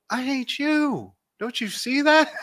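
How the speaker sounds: high, slow put-on voice; a hint of spite; mock Ray. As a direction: deliver the insult deadpan. Ray arguing with Lisa.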